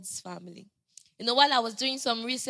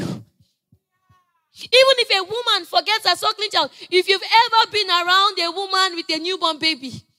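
raised, louder preacher's voice; fervent preaching; 6 semitones higher, 5 dB louder than usual. Real, not acted. Preacher to congregation about a mother's love, fervent preaching. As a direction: preach it with rising force. Preacher drives the point home.

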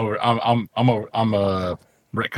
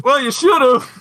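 Jordan voice; 10 semitones higher, 4 dB louder than usual. Retold or imitated. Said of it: "Jordan just goes".

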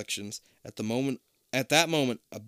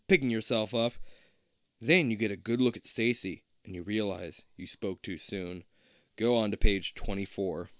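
ordinary delivery, nothing marked

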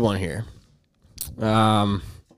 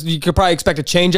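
usual speaking voice

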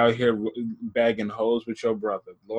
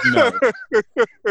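high squeaky no; 12 semitones higher, 6 dB louder than usual. Pretend. Mocking autocorrect, high voice.

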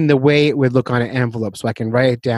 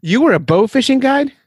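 surprised; 10 semitones higher than usual. Real, not acted. Host hears surprising job.

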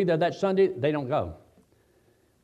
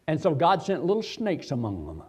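ordinary delivery

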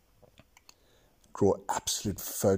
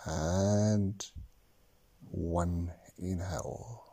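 slow counting, whispered on exhales; slow breath counting; 7 dB quieter, slower than usual. Acted. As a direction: count each breath calmly, long pauses. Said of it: "actually count your breaths now".

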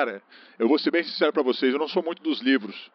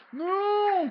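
anguished cry; 4 dB quieter than usual. Acted. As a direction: yell "no" as they escape. Blind man tries escaping again.

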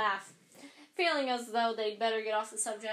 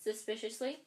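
plain reading voice

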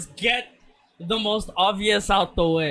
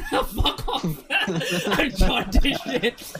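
laughing through the words; cracking up while talking; 4 semitones higher, much slower than usual. Real, not acted. Laughing at being called jaundiced.

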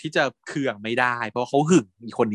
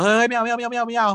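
frantic refusal; 10 semitones higher than usual. Retold or imitated. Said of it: "ปานก็ปฏิเสธพลวันเลยนะฮะ".

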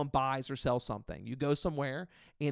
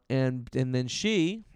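ordinary talk

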